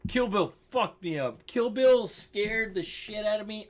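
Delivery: usual speaking voice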